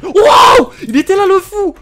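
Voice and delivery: high panicked shouting; scared horror game streamer. Real, not acted. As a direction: shriek in real panic. Jump scare by Siren Head.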